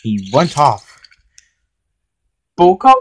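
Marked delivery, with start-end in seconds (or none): 2.6-2.9 loud, high appeal by name; calling out. A narrator's reading. Film manager turns to Boko for support, calling.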